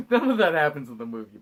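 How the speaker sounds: usual speaking voice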